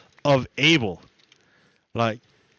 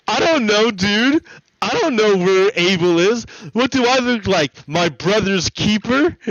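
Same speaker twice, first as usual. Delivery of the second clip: higher, louder bratty denial; defiant Cain; 6 semitones higher, 3 dB louder than usual. Retold or imitated. Cain brushing off God's question, bratty and louder. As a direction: shrug it off like a mouthy teen. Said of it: "Cain to God".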